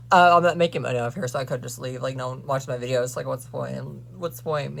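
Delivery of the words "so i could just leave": ordinary delivery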